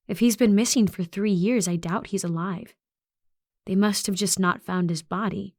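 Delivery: ordinary delivery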